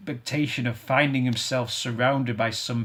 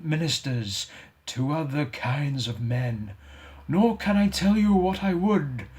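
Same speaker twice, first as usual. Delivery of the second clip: mournful ghost; 3 semitones higher than usual. Acted. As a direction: grieve for the wasted life. Ghost describing its wandering.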